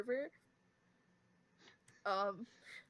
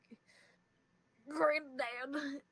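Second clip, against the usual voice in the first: Vinesauce Joel impression; 5 semitones higher, 4 dB louder, much slower than usual. Shown as a demonstration. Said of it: "people say it sounds exactly like Vinesauce".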